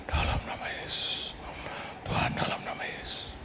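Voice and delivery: breathy whispered prayer under the breath; hushed whisper, Jesus' name. Retold or imitated. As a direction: pray secretly so they can't hear. Praying silently before collectors.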